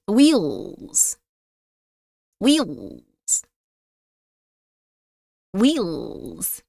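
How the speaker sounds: low, slow spooky whisper. Pretend. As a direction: intone it like a ghost. Spooky repetition of wheels.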